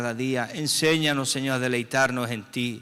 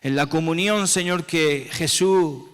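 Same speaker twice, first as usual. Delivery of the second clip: higher, louder, slowed prayer cry; fervent cry; 6 semitones higher, 7 dB louder than usual. Real, not acted. Opening the communion prayer, crying out to God. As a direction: lift the opening plea high and slow.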